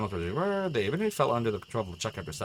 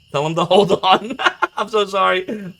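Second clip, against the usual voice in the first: nervous laughter; 10 semitones higher, 10 dB louder than usual. Real, not acted. Laughing panic after mistake.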